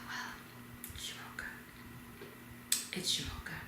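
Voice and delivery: very soft, halting introduction; starstruck murmur. Retold or imitated. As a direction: barely get your own name out, hushed. Starstruck, giving her name.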